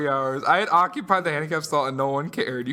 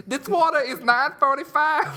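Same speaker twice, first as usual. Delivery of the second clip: high-pitched, raised voice of an arguing vendor; mock arguing; 10 semitones higher, 4 dB louder than usual. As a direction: imitate someone arguing loudly with a customer. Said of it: "who's arguing with a customer".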